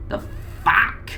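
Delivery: usual speaking voice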